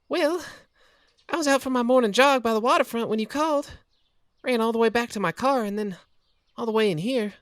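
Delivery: best friend venting her panic after racing over; Kathy relieved and shaken. Acted. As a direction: vent the fright, then settle. Kathy explains.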